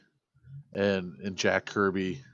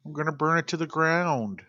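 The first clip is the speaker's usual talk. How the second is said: mock threat in a higher voice; Loki; 7 semitones higher than usual. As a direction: act out the vow, pitched up. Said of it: "like, Loki said when".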